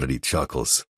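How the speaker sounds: ordinary talk, nothing marked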